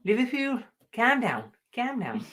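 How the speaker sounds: high, hammed-up Scouse catchphrase; Liverpool accent. Co-host doing regional impressions, high Scouse accent. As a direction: caricature a Scouser calming people down. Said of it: "In Liverpool".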